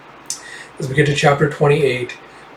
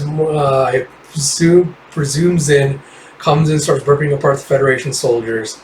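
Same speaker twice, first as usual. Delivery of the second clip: ordinary delivery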